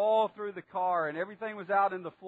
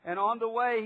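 normal talking voice

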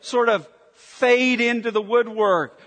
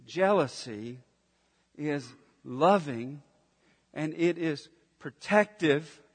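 soft restatement; 4 semitones lower, 5 dB quieter than usual. Real, not acted. Preacher restates key point softly.

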